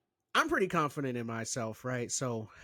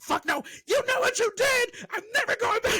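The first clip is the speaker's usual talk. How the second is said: high, loud indignant yell; mock outraged shout, much higher and louder; indignant refusal; 7 dB louder than usual. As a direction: refuse her outright, voice shooting up. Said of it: "I was like".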